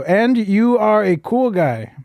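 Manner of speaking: put-on slow, high Chinese accent. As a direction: stretch every word in a high Chinese accent. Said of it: "I'll do the Chinese accent".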